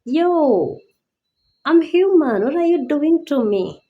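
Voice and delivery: high, loud, exasperated protest; indignant outburst. Retold or imitated. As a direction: fire back in disbelief. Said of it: "you know, like".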